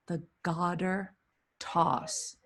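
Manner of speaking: garter said dropping the R, gahdah; New England accent. Shown as a demonstration. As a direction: say The garter with Boston-style no R. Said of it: "The New Englander in me just came out".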